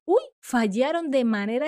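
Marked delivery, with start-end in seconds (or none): 0.0-0.2 a sudden louder uy of alarm; mock alarm at a near miss; surprised uy. Shown as a demonstration. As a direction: blurt out uy as if caught off guard. Interjection example, acted.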